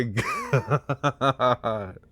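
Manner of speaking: ordinary talk, nothing marked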